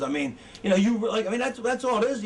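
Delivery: ordinary talk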